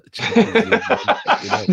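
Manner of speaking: laughing through the words. Real, not acted. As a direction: chuckle the words out. Laughs while dodging the question.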